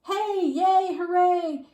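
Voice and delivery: high, loud cheer; crowd yelling. A narrator's reading. Crowd yelling for the lion, high and loud. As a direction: yell like a happy crowd. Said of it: "the crowd kept yelling".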